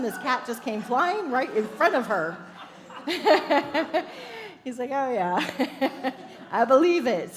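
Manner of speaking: loud, laughing through the words; laughing punchline. Real, not acted. Preacher delivers the cat punchline, laughing. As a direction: tell it while cracking up.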